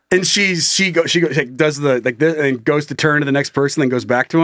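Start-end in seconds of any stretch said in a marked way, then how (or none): none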